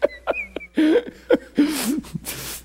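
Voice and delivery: long, high-pitched laugh; real laughter. Real, not acted. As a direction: keep laughing helplessly at your own act. Laughs at own demonstration.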